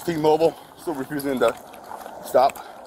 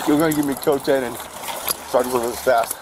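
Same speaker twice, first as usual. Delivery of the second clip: ordinary talk, nothing marked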